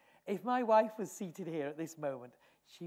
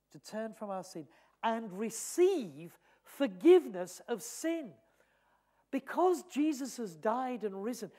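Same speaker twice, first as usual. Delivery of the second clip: higher and louder, fervent preaching; preacher urging the congregation to tell everyone; full-force preaching; 6 semitones higher, 8 dB louder than usual. Acted. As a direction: proclaim it from the pulpit.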